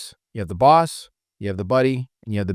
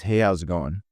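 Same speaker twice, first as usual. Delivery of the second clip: lower, downward greeting; boss tonality; 4 semitones lower than usual. Shown as a demonstration. Trainer contrasting three greetings for reps, lower boss tonality. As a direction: drop the pitch through GOING, like the boss. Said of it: "You see those three different ones?".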